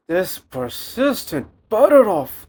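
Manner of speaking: loud, high, drawn-out outburst; exasperated shout. Real, not acted. Cook fighting with the butter, exasperated shout. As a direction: shout it slowly in frustration at the butter. Fed up with butter while cooking.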